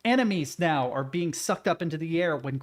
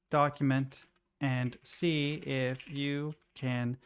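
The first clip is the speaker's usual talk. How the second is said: slow dictation; 3 dB quieter, much slower than usual. Real, not acted. Dictating a prompt while typing.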